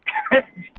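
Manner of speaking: sudden squeaky laugh; high-pitched laugh. Real, not acted. Laughs about the dog.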